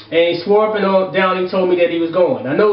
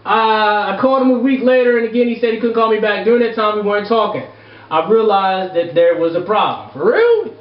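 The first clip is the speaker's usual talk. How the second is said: normal talking voice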